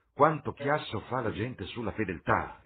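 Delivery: loud, high-pitched exclamation; exclaiming. A narrator's reading. Lord Henry scoffs at talk of fidelity, exclaiming. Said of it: "esclamò Lord Henry".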